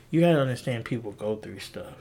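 ordinary delivery

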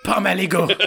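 Pomme aligot repeated in French; French-style Pomme aligot; French accent. Shown as a demonstration. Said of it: "Can you say it the French way?".

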